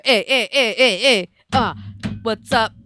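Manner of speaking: loud hype calls to a crowd; hype man. Pretend. Hype man calling out to a pretend crowd. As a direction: pump up the crowd.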